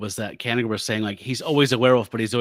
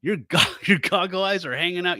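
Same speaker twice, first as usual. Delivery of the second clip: mocking jab, a bit louder and higher; snarky jab; 6 semitones higher, 3 dB louder than usual. Werewolf mocking the doctor, lightly voiced snark. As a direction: tease the doc with a sneer. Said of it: "doing his normal shit".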